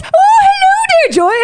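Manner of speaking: very high, hammed-up British mum; exaggerated high British greeting; British mom. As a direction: trill it like a delighted British mother on the phone. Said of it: "sounded like a British mom".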